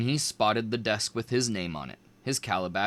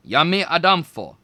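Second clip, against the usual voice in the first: loud, higher proud call; spell called out; 5 semitones higher, 8 dB louder than usual. A narrator's reading. Student casting her spell in class, called out loud. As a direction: ring the spell out.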